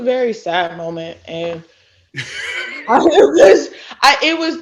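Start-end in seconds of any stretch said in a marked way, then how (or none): 2.1-2.8 awkward laugh. Real, not acted. Awkward laugh at a sad moment. 2.9-4.6 laughing. Real, not acted. Laughing through a sad memory.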